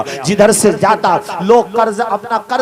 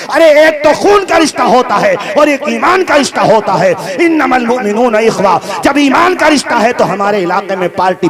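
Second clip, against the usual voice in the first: fiery sermon against feuds; preaching at full force; 6 semitones higher than usual. Acted. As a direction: rouse the crowd to unity. Fervent call for brotherhood.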